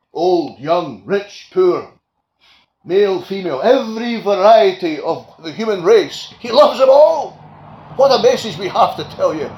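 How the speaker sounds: fervent preacher's climax; preaching at full force. Acted. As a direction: build the list to a triumphant peak. Preacher building on God's love.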